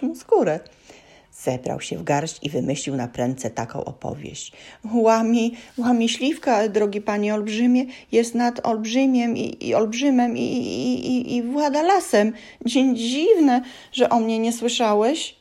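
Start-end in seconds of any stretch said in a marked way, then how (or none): 4.9-15.4 high, stumbling nervous tale; nervous high elf. A narrator's reading. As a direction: bluff nervously, tripping over words. Elf invents story, nervous.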